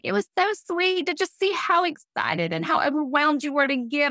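lightly mocked sweet enthusiasm; gushing pastor. Pastor gushing over her giving.

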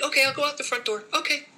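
high, quick mock puppy voice; lightly voiced hopeful puppy. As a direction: give the timid puppy a small eager voice. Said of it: "she's like".

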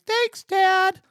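put-on very high, small kid voice; prepubescent boy voice. Boy thanking his dad at bedtime, very high kid voice. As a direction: squeak it out like a boy before his voice broke. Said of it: "'cause I still hadn't gone through puberty yet".

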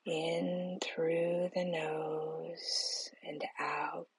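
slow, soft whisper, guiding each breath; whispered guided meditation. Acted. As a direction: breathe the words out slowly, barely voiced.

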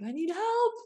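hammed-up helpless shopper; mock plea. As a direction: beg for help playfully. Pleading to clerk.

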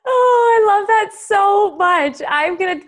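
loud, high delighted outburst; overjoyed exclamation. Real, not acted. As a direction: gush with real delight. Delighted reaction to adage.

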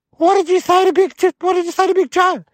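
high, alarmed questioning; scared uncle. Acted. Uncle to nephew after the chicken's headshake, scared. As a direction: demand it in a high-pitched panic. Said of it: "my uncle got real scared".

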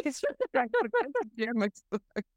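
helpless laughter breaking up the line; laughing. Real, not acted. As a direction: crack up while saying it. Laughs at her own irony.

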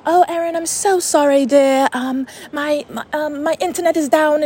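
high-pitched mock British accent. Psychic apologising to client by voice memo, imitated British accent. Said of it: "She's, like, a British accent".

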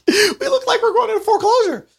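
high, mocking imitation of the wife; mock worried wife; wife's voice. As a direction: put on a squeaky wife voice. Wife voiced in retold story.

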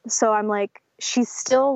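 normal talking voice

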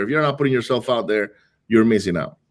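ordinary talk

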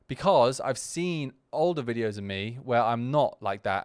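usual speaking voice

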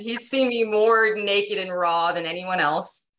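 normal talking voice

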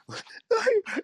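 squeaky high laugh; high-pitched giggle. Real, not acted. Laughs at own joke.

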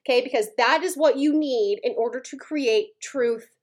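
usual speaking voice

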